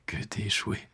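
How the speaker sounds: soft, reluctant admission; hushed confession. Acted. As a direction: let it out softly, almost whispered. Reluctantly confesses a painful hope.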